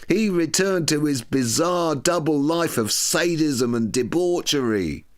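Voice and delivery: high, lurid read of a scandal sheet; mocking tabloid headline. Narrator reading a Daily Mail piece, mock-sensational and high. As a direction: ham up the tabloid outrage. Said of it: "The Daily Mail harmonised with a piece".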